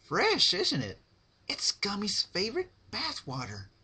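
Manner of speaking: high, sing-song sweet menace; bright cheery threat, voiced high; Pinkie Pie voice. Narrator gives Pinkie a high sweet creepy voice.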